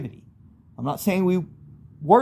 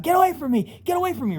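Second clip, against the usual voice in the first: much higher, frantic cry at attackers; terrified yell; 13 semitones higher, faster than usual. Retold or imitated. Swinging a bat at imagined demons, terrified high yell. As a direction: yell to keep monsters away in panic.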